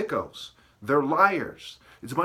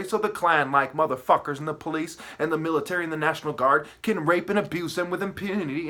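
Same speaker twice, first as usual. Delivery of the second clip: ordinary talk, nothing marked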